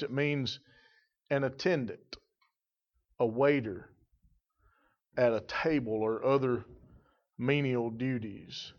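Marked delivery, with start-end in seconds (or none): none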